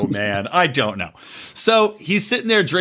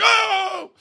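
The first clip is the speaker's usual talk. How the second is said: mock moan. Guy's climax exclamation.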